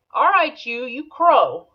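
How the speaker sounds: calling out to the rooster. Retold or imitated. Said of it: "but she goes".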